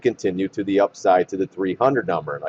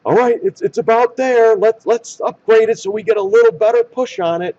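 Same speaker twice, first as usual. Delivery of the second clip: much higher, mocking voice of the insiders who push an upgrade; 12 semitones higher, 4 dB louder than usual. Trader mocks insiders planning an upgrade, high mocking voice. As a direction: sneer it out as the schemers deciding to pump the stock. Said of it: "It's almost like".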